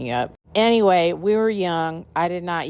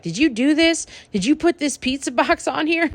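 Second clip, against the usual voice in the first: high squeaky outraged accusation; mock outraged rich boy; 11 semitones higher than usual. Rich boy accusing T Y over the pizza box, high mock outrage. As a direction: squeak it out like an entitled brat.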